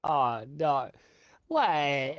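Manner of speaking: ordinary delivery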